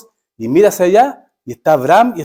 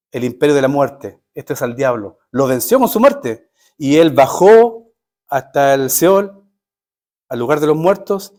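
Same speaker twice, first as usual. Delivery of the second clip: ordinary delivery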